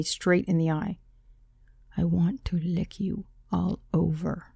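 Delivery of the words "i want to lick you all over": each word slow and precise; slow, soft, deliberate seduction. A narrator's reading. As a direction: lay every word down deliberately. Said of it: "He said it so deliberately and so precisely".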